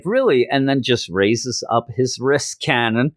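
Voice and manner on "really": high, disbelieving "really"; incredulous. Retold or imitated. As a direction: scoff in disbelief. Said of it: "Chance like".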